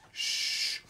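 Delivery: unvoiced hushing sound; whispered shush. Host hushing his own Suffolk yells, whispered shush. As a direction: hush yourself sharply.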